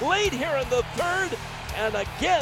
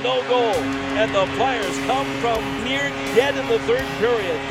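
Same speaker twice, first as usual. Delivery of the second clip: ordinary talk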